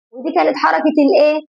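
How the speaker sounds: ordinary talk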